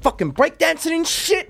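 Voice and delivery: high, hammed-up mocking yell; imitating Big Dave. Retelling funniest movie moment, high mock voice. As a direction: squeal the insult like a cartoon thug. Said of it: "He goes".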